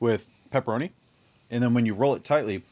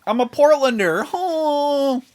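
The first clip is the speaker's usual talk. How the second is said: mock proud declaration; 11 semitones higher, 5 dB louder than usual. Hammy high mock declaration.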